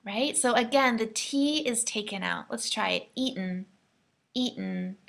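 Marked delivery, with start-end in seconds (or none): 3.2-5.0 eaten with the T swallowed; American eaten, softly; glottal eaten. Shown as a demonstration. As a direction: stop the T in eaten. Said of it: "just like that".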